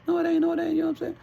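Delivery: squeaky, very high imitation of her; shrill mock girl voice; high mock protest.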